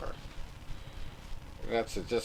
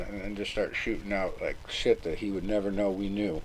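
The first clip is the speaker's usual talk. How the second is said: ordinary talk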